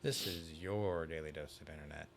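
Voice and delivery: flat, even internet host read; deadpan each word the same; monotone voice. Retold or imitated. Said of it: "He has that monotone voice".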